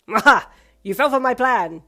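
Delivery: loud gleeful villain voice; villainous cackle. A narrator's reading. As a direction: gloat like a cartoon villain. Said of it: "you cackled in a villainous voice".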